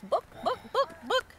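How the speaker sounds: high little chicken clucks; chicken squawk. Acted. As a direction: cluck it out like a hen. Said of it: "and squawks".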